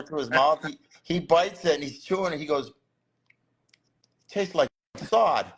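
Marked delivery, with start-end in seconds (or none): 4.3-5.5 slow, grimacing disgust over the food; disgusted taste. Retold or imitated. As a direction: drag it out like it tastes awful. Friend tasting artichoke, disgusted.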